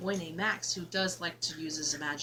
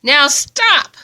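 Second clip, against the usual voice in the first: loud, higher sharp command; stern order; 7 semitones higher, 22 dB louder than usual. Acted. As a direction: stop them like a strict king. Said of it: "Max said".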